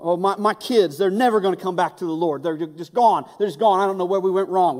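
lightly played worried parent; despairing parent. Preacher voicing a parent in despair, a touch loud. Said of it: "You know".

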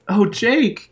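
crying out. A narrator's reading. Cassie cries out at Jake in dismay, high. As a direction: cry his name out in shock. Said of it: "Cassie cried".

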